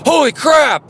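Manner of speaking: imitating a sudden shriek, high and loud; startled outburst. Wife waking suddenly in bed during a storm, startled outburst. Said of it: "like that".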